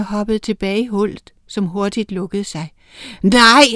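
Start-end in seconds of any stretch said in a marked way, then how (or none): 3.2-3.6 startled loud shout of denial. A narrator's reading. Mason shouting at the closing wall. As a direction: bellow a frightened no. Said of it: "råbte Sand".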